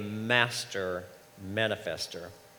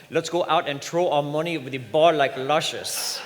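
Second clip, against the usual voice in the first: playful imitation of the mother's Caribbean accent; mock Caribbean accent; 4 semitones higher, 5 dB louder than usual. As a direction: tease your friend in her Caribbean island lilt. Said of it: "I would say".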